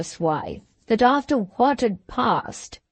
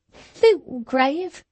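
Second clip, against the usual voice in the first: incredulous, scornful rising question; scornful disbelief; 5 semitones higher than usual. A narrator's reading. Wife scoffs at the name of the rival doctor, incredulous. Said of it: "strong feeling of ... distrust".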